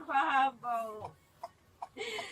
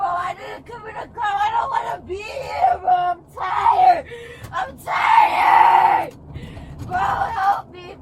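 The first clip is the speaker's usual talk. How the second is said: screaming, much louder and higher; desperate scream; 3 semitones higher, 10 dB louder, slower than usual. Real, not acted. Arrested woman screams she is tired, desperate. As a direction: scream for help, worn out.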